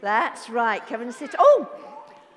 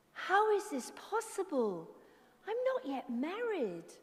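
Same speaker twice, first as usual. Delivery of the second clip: put-on young girl voice; 6 semitones higher, 5 dB quieter than usual. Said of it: "she spoke to Gabriel".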